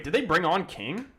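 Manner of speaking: usual speaking voice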